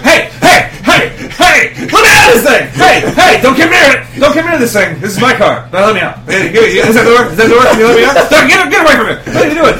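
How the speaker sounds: high, loud, frantic dogs yelling from a car. Retold or imitated. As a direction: shout like a dog guarding the car. Voicing barking dogs in car.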